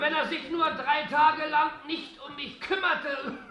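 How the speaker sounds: anxious, half-laughing complaint; agitated. Acted. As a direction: fret loudly about the doctor. Hypochondriac pleads laughing.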